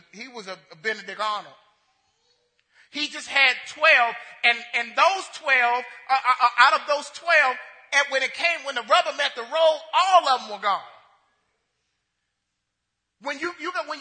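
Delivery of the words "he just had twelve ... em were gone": preaching at full force. Acted. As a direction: preach it out to the whole room. Pastor drives home his point.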